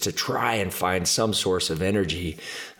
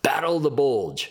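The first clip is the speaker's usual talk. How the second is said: higher-pitched mock calling out; retold yell; 7 semitones higher than usual. Teammate yells out an answer to coach, retold yell. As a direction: imitate someone blurting an answer. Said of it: "somebody just yells out".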